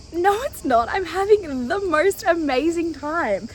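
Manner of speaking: retold high-pitched objection, slowing down; emphatic protest. Solo traveler correcting a pitying stranger, emphatic retold protest.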